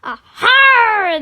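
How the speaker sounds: loud, high pirate roar; long, full-throated pirate cheer; roared reply. Acted. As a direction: roar back like a pirate crew. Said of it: "they roared back".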